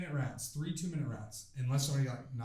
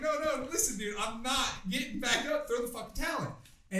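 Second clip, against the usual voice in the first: insistent plea; 9 semitones higher than usual. Retold or imitated. Pleading with cornerman to quit.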